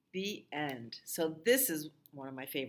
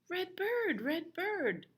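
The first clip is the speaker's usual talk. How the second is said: much higher sing-song chant of the refrain; 14 semitones higher, slower than usual. Acted. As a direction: lilt it up high for the children.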